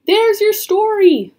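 high, loud and excited; urgent exclamation. A narrator's reading. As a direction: call it out over the commotion. Scholar calls out amid danger.

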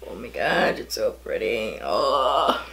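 hammed-up low awed groan; mock deep voice. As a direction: drop way down like a dramatic deep voice.

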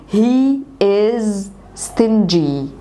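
very slow, each word drawn out; slow model sentence. Shown as a demonstration. Teacher modelling a sentence for students, very slow. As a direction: say it slowly for learners to copy. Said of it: "احفظ الجملة زي ما أنا بقولها لك كده".

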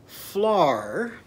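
"Flar" stretched on the "ar"; vowel swapped to "ar". Shown as a demonstration. Teacher leads children repeating, drawn-out "Flar". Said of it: "do that after me".

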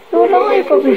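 high-pitched exclamation of relief; joyful cry. A narrator's reading. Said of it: "she cried".

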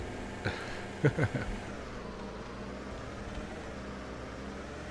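long, soft wheezy laugh. Real, not acted. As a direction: laugh quietly, almost without voice. Laughing at the game.

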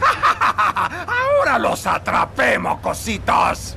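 slow, triumphant villain shout; gloating shout. Acted. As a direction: gloat over the cornered kids.

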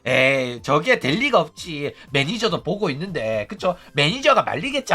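low, offhand imitation of unworried viewers; casual dismissal. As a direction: brush it off as nothing. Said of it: "웃으면서 별거 아닌 것처럼". (별거 아닌 것처럼 낮게 넘기는 말투)

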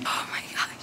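breathless unvoiced whisper; cold whisper. Real, not acted. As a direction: gasp it out in a whisper. Shock in ice water.

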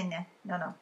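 ordinary delivery, nothing marked

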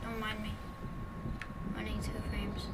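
soft whispered grumble to himself; whispered aside. Real, not acted. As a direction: whisper it under your breath. Muttered repeat of own complaint.

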